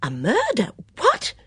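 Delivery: sudden very high What; startled gasp at the news; shocked. Acted.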